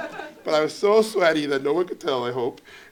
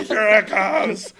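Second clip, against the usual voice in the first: high, loud, drawn-out build-up; grand anticipation; 7 semitones higher, 9 dB louder, slower than usual. Retold or imitated. Nervous pitcher rallying himself before the big pitch, grandly excited. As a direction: announce it like a battle charge.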